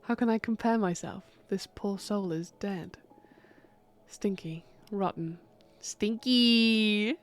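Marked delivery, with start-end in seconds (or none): 4.1-5.4 whisper. Acted. Whispered to herself. 5.8-7.2 ghostly echo. Acted. Ghost repeats word.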